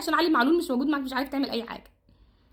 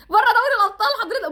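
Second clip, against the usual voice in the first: higher, louder and fast outburst; exasperated shout; 8 semitones higher, 5 dB louder, faster than usual. Real, not acted. Fan yelling at coach about elimination, fast shout. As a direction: blurt it out in exasperation.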